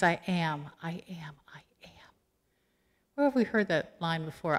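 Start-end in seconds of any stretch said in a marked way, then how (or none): 0.0-2.1 fading whisper. Acted. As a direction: let the divine name fade into a whisper.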